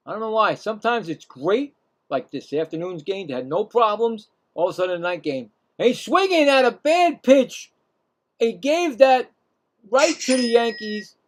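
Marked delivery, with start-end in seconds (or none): 5.8-11.2 higher and louder, dismayed at the swing; exasperated call. Real, not acted. Calling a batter gifting the Yankees, exasperated and loud. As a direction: react as he chases a bad pitch.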